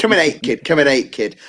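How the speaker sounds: playful put-on Northampton accent. Shown as a demonstration. Host demonstrating his local speech to guests, Northampton accent. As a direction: show off your Northampton accent. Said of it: "That's my Northampton accent".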